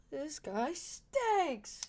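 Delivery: very slow, drawn-out grumble swooping in pitch; soft, elongated put-on complaint; exaggerated disgust. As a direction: stretch it out in mock disgust. Mocking drawn-out aside.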